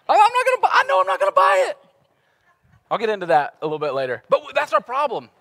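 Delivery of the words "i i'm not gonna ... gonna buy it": squeaky inner voice. Retold or imitated. Inner voice refusing the cookie dough.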